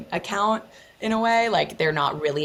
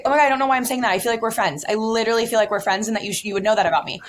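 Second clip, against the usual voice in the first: higher, louder, fast gushing; gushing fan; 7 semitones higher, 4 dB louder, much faster than usual. Retold or imitated. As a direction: spill it out like a fan who overshared. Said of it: "She's like".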